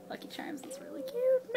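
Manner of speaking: 3 breathe it like a secret; 1 whisper. Real, not acted. 4 telling viewers about the shirt, in a secret whisper.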